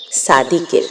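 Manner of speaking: plain reading voice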